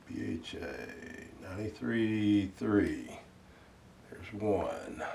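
very quiet, drawn-out readback; muttering to self. Real, not acted. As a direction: murmur it slowly while jotting it down. Talking to self while recording weight.

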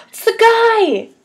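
high, slow startled exclamation; surprised gasp. Acted. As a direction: gasp and blurt it out, shocked. Spots the guy, gasps.

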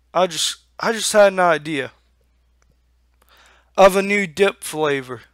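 normal talking voice

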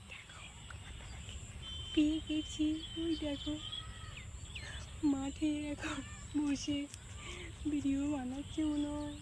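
softly spying on her husband, hushed; hushed, sneaking. Real, not acted. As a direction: murmur it so he doesn't notice.

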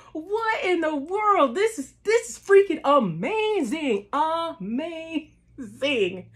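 marvel, then savour the word; amazed gushing. Retold or imitated. Fan reliving the show, amazed gushing. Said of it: "I'm just like".

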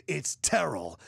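"Terrell" stressed on the first syllable; annoyed name correction. Retold or imitated. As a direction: firmly repeat "Terrell" as TER-ul. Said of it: "pissed, and goes".

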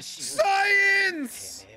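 high-pitched triumphant yell; excited shout. Real, not acted. Viewer cheering a clever underwater trick, excited shout. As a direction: shout it like a sudden revelation.

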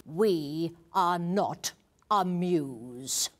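slow regal decree. Acted. Queen's regal pronouncement.

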